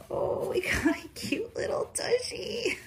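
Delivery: high whispered coo. Real, not acted. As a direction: coo it playfully. Baby talk whisper.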